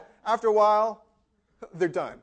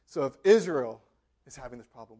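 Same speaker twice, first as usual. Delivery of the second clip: ordinary talk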